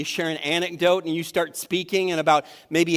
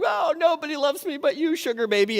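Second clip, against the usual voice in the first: mock sad baby talk; 10 semitones higher than usual. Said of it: "I'm like".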